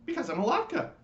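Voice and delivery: very high, loud squeaky wail; crying latke. Acted. Latke complaining to the lights, high wailing squeak. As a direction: squeal out your pain. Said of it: "said the latke".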